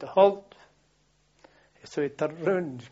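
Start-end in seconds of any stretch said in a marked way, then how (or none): 0.0-0.5 loud, raised halt order; shouted command. Retold or imitated. Sergeant halting the runners by the golf club, shouted command. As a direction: shout it like a sergeant stopping the squad. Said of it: "he shouted".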